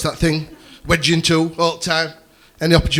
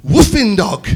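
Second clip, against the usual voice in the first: lightly imitated small boy, higher and louder; child's innocent correction; 7 semitones higher, 6 dB louder, much slower than usual. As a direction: exclaim it as a little son. Son's innocent version, acted.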